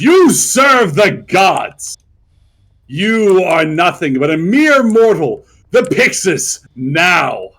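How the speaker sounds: slow, booming god's roar; booming shout. Acted. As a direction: thunder down at the mortals.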